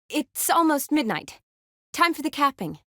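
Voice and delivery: pitched well up, same volume. Acted. Second take, pitched much higher.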